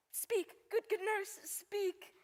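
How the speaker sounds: slow, drawn-out begging; pleading impatiently. Acted. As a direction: stretch out each plea for news. Juliet begs nurse for news.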